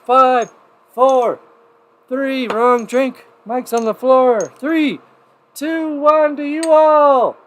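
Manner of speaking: high, loud count, slowing on the toast; shouted countdown. Real, not acted. Host counting down and toasting his viewers, loud and high. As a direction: belt the count and raise the glass.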